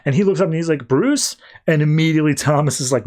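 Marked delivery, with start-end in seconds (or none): 0.9-1.4 sudden high, startled "Bruce?"; surprised question. Retold or imitated. Waking Clark startled to see Thomas, high surprised call. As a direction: jump up in pitch, stunned at the face.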